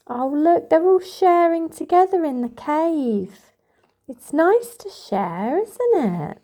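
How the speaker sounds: plain reading voice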